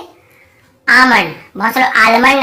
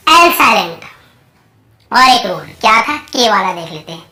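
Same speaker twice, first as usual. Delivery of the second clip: ordinary delivery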